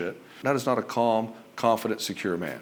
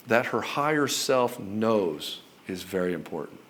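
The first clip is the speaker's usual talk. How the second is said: ordinary talk, nothing marked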